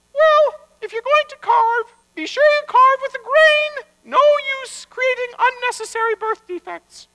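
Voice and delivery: very high-pitched lecturing voice; bossy wife. Acted. Wife warning rabbi to carve with the grain, bossy. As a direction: lay down the rule in a high voice.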